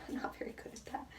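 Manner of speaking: quick sheepish whisper; quick whisper aside; whispered admission. Real, not acted. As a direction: whisper it fast, a little embarrassed. Admitting weakness.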